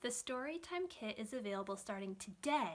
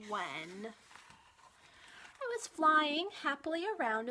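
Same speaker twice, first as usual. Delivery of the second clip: put-on reading to children; 5 dB louder, slower than usual. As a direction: read it like a children's librarian holding a picture book up to the kids. Reads picture book to kids.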